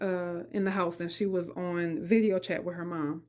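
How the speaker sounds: normal talking voice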